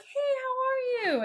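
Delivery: very high, drawn-out imitated hello; slow singsong greeting way up high; gushing greeting. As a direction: mimic a crew fussing over a dear visitor. Crew greeting the old man.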